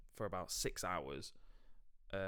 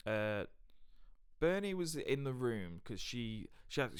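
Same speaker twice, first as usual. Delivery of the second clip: ordinary talk, nothing marked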